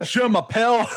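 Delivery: high, loud mock French from a Scottish guy; Scottish guy's French. Mimicking a Scottish guy speaking French, mock accent. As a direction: ham up a Scot mangling French. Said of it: "That's a great impression".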